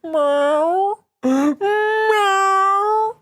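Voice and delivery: very high, long wailing meow; imitated cat meow. Streamer imitating a cat complaining at the door, meowing. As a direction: mimic a complaining cat, stretched and squealing. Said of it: "They're meowing up a storm".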